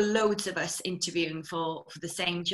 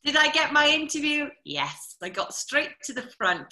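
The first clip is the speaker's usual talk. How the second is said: usual speaking voice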